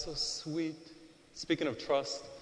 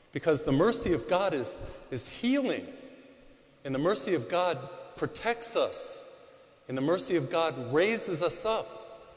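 higher, building emphasis on mercy; rising preaching; 4 semitones higher than usual. Acted. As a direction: preach it with growing fervor.

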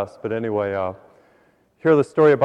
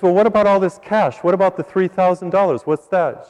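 raised, louder demanding questions; insistent husband; 6 semitones higher, 4 dB louder than usual. Acted. Husband questions wife about the hidden cash, insistent and demanding. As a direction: press indignantly for an explanation.

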